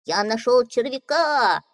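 proud duckling. Acted. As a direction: boast like a little duckling.

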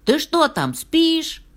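high, loud impatient call; loud, high-pitched storybook holler; calling through door. A narrator's reading. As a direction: shout high to someone behind a door. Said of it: "крикнул из-за двери".

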